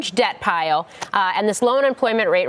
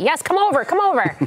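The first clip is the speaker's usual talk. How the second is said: excited; 7 semitones higher than usual. Real, not acted. Eagerly inviting guest on set.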